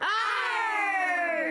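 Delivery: shouted pirate arr. Pretend. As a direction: bellow it like a pirate. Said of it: "Si quieren jugar mi juego de piratas, digan".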